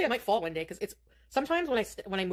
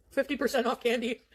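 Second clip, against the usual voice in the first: laughing; 5 semitones higher than usual. Real, not acted. Laughs while speaking.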